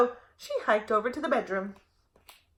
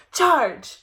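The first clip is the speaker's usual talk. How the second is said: dinosaur yell; 3 semitones higher, 5 dB louder than usual. A narrator's reading. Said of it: "a trio of dinosaurs yelling".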